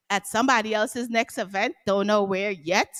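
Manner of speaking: ordinary talk, nothing marked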